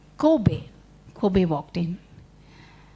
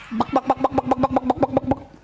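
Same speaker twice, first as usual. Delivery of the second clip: clucky buck-buck duck noise; imitated duck call; duck sounds; faster than usual. Shown as a demonstration. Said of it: "How does a duck come?".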